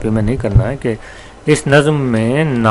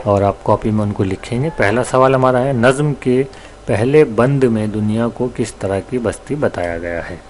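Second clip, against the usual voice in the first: ordinary talk, nothing marked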